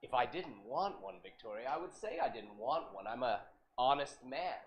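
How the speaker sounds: a hint of a patient old man. Plays Arnold the plumber.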